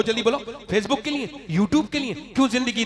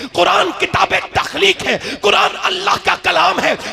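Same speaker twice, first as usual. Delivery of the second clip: higher and louder, shouting the sermon; fiery preaching; 8 semitones higher, 10 dB louder than usual. Acted. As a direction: thunder it out to the crowd. Preaching at full force.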